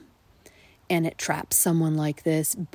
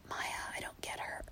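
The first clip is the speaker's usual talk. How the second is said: husband's whisper; 17 dB quieter than usual. Retold or imitated. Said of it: "He's just like".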